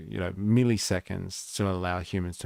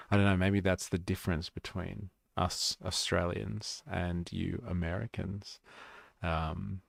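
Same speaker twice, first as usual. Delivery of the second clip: ordinary talk, nothing marked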